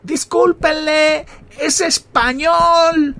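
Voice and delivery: loud, high, long-drawn mock shout; Angelito calling out. Friend apologising to all the softball players, loud drawn-out shout. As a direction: stretch every word out like an announcement across the field. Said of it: "mi amigo Angelito dijo".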